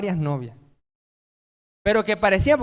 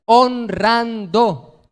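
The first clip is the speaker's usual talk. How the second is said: Honrando, each syllable held long; drawn-out answer; 3 semitones higher, 6 dB louder, much slower than usual. Acted. Preacher answers his own question to the congregation, slow and drawn out. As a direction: stretch Honrando out very slowly, a bit louder.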